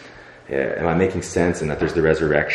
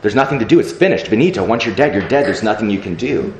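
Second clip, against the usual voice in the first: higher, louder hopeless protest; despairing outcry; 5 semitones higher, 5 dB louder than usual. Retold or imitated. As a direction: cry out to God that it's all over. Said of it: "I say to him".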